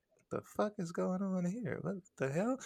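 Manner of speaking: high, hushed disbelief; small high voice, mock alarm; baffled quote. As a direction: imitate a bewildered onlooker, soft and high. Friend watching him, baffled.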